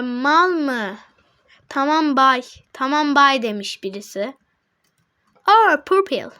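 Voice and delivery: normal talking voice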